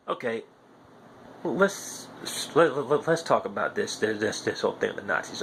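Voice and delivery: ordinary delivery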